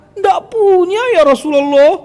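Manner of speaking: very high, loud plaintive cry; acted helpless protest. Companion tells Prophet he has none, high protest.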